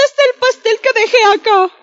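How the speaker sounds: a lightly voiced curious mom, quick and high; mother's voice. Mother quizzing her son about the missing cake, high and quick.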